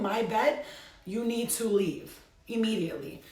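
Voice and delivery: loud, forceful order to leave; demonstrating banishing a spirit from the bedroom, loud and stern; stern command. Pretend. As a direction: lay down the law to an intruding spirit. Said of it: "Sit at the corner of your bed and go".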